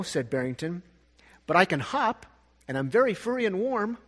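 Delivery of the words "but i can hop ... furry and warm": a hint of a small bunny, pitched higher; bunny making his case, voice lifted; pleading bunny. Bunny pleads with the beaver to come, lightly higher voice.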